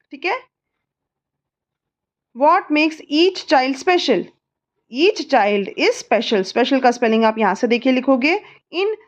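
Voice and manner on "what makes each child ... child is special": very slow, word by word; slow dictation. Shown as a demonstration. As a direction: dictate the answer slowly. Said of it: "slow drill". (धीरे धीरे लिखवाना)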